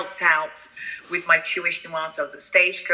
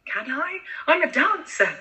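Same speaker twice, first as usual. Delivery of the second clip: laughing protest; 10 semitones higher than usual. Real, not acted. Child insists she is a dancer.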